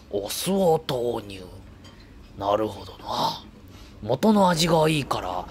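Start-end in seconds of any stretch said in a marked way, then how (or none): 0.1-3.4 lightly voiced gourmet inner monologue; Goro narration voice. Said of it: "五郎さんのナレーション".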